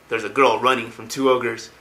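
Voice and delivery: plain reading voice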